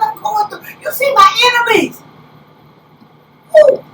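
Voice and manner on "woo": normal talking voice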